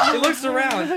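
ordinary delivery

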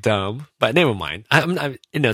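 usual speaking voice

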